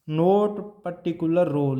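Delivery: very slow, word by word; slow dictation. Shown as a demonstration. Teacher giving the class a point to write, slowly. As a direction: dictate it for notes. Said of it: "teacher dictating, later restated".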